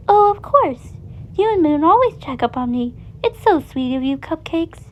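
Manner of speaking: lightly voiced sweet chicken; sweet Chica. A narrator's reading. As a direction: thank him sweetly. Chica fondly thanks Monty.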